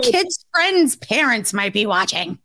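mock prim wife.

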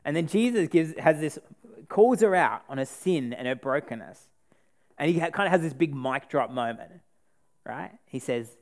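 normal talking voice

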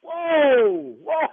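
loud startled whoa, stopping him cold; shocked husband halting the doctor. Real, not acted. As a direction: cut him off hard. Outraged husband on prank call.

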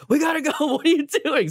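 high-pitched mock yell of an impatient boss; retold yelling. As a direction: imitate a boss hollering through a door. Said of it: "I hear him yelling up the door".

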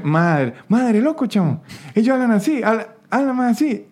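Mérida lilt swinging up high; clichéd country Mérida accent, high and lilting; sing-song Mérida accent. Shown as a demonstration. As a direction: exaggerate the rural merideño sing-song.

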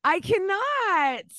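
high, slow disbelief; incredulous. Real, not acted. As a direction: stretch it out in amused shock. Amused disbelief at message.

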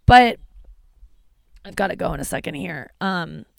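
normal talking voice

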